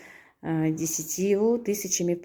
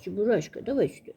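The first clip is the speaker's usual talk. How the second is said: normal talking voice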